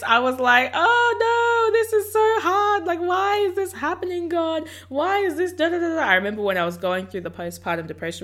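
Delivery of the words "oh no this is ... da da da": high-pitched mock wail; hammed-up self-mocking whimper; wimpy complaining. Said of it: "a little bit of a wimpy attitude".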